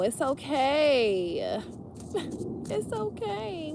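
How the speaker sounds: reassuring. Real, not acted. Reassuring after laugh.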